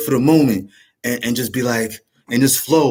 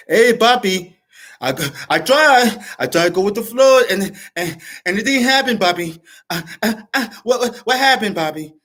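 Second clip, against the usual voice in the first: put-on Dominican accent; 7 semitones higher than usual. To viewers from the Bronx, a hammed-up Dominican accent. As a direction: play a flustered Dominican guy from the Bronx, pitched way up. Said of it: "I'm lacking a little bit on my Dominican accent".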